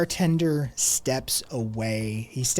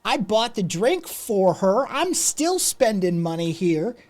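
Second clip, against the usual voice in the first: indignant protest; 6 semitones higher than usual. Acted. Rude customer protesting to the man, indignant and raised. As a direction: whine that you're a paying customer.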